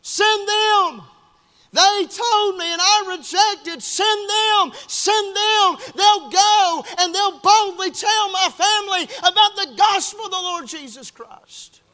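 high, loud desperate wail; crying out, acted. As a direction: plead at full voice from torment. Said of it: "they're crying out and saying".

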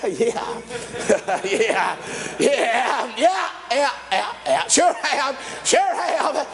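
chuckling, boastful yeah yeah; laughing brag. Retold or imitated. Groom laughs off praise from the head servant. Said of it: "he begins to brag on him and boast on him".

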